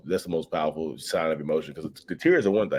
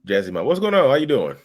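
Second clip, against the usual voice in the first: excited greeting; 7 semitones higher, 6 dB louder than usual. Real, not acted. Greeting a viewer live.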